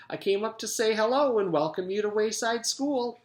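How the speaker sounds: a cheerful lunch lady. A narrator's reading. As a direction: announce yourself through the door. Miss Mush voice.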